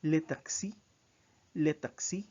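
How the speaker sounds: Les taxis with a clear French les; French pronunciation. Shown as a demonstration. Teacher modeling French plural for students, careful pronunciation. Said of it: "So you say".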